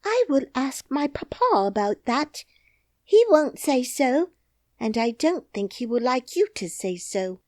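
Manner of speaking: put-on high indignant little girl; princess. Princess warns the nurse, high and indignant.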